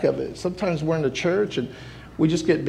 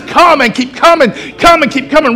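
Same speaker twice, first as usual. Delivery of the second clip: God's call shouted; 11 semitones higher, 11 dB louder than usual. Retold or imitated. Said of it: "He says".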